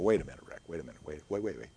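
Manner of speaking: soft, low aside voicing a doubter; hushed, low mock objection from the pews; objecting listener. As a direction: interject under your breath, repeating yourself. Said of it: "some of you are saying".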